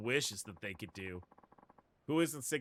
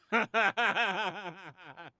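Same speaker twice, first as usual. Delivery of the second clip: loud laugh; 7 semitones higher, 6 dB louder than usual. Real, not acted. Streamer laughs at own misread.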